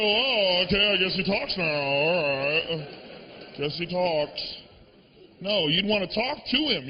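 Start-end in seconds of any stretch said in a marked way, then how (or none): 0.0-4.5 a lightly voiced shrug, lower and offhand; blasé acceptance. Stoner shrugs at the talking dog, lightly blasé. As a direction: shrug it off like nothing happened. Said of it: "standing around and go".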